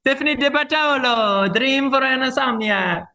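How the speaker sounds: high, loud hammed-up Italian accent, still going; fake Italian accent. Naming the author in the same fake Italian accent. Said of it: "That was a combination of several accents".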